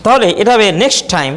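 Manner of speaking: usual speaking voice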